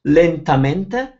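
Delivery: "lentamente" spoken very slowly, syllable by syllable; slow demo. Shown as a demonstration. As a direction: stretch out "lentamente" carefully. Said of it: "The correct one in Italian would be".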